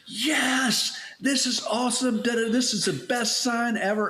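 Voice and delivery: high, thrilled squeal; excited girl. Retold or imitated. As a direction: squeal it like an overjoyed teen. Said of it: "And she is like".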